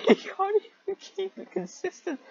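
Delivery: high, far-up sarcastic jab; high mocking complaint; mocking squeaky voice. Real, not acted. Mocking the author.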